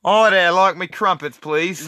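hammed-up British crumpet-lover. Shown as a demonstration. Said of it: "do a British voice".

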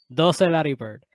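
usual speaking voice

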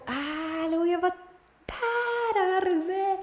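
high hammed-up threat, restrain me; high mock-furious cry, hold me back; mock fury. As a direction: pretend to lose it, half laughing. Mock rage at rude driver.

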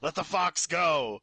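forceful demand. Acted. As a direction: order it with weight on every word. Player orders the trapper in character.

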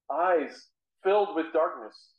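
normal talking voice

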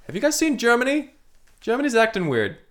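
Canada pokes his head in, fretting in a high voice; high worried Canada. Acted.

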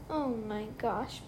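usual speaking voice

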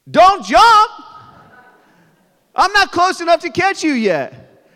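put-on high, loud, panicked yell to a child; panicked shout. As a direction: yell a warning in alarm. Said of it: "What's your first instinct as a father?".

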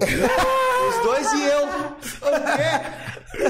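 high squeal of laughter through the words; cracking up mid-sentence; laughing. Real, not acted. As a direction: laugh it out, pitch jumping up. Laughing at his own joke.

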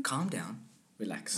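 soft, soothing calm-down to a nervous actor; calming coach. Retold or imitated. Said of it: "And I'm like".

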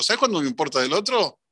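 ordinary talk

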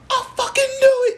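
very high, loud accusing cry; squealed mock outrage; mock outraged yell. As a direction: burst in yelling, hammed-up. Acts out catching someone out.

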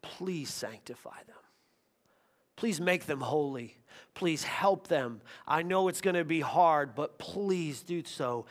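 soft, slow, pleading prayer voice; Jesus asking the Father, voiced quietly and tenderly; gentle prayer. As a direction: pray it softly, almost under the breath. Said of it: "And he says".

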